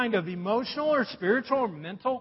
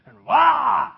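loud, hammed-up child wail; mock kid wail; 5 semitones higher, 11 dB louder than usual. Preacher mimicking kids blowing up in the car, loud wail. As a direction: burst out like squabbling kids.

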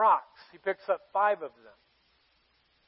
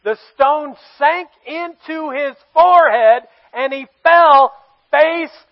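loud and higher, preaching at full force; excited shouting; 6 semitones higher, 14 dB louder than usual. Real, not acted. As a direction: shout out the triumph. Climax of the story.

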